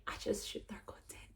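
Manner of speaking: breathy whisper under her breath; whispered aside. Real, not acted. Vlogger muttering a final word to herself, whispered. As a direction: drop to a whisper.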